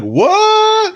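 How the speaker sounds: high squeaky mock what; imitating a baffled guy; shocked what. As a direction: squeal it in disbelief. Retold boss reacting in shock.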